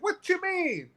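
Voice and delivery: put-on dad's puzzled question in a high Arabic accent. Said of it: "he had this heavy Arabic accent".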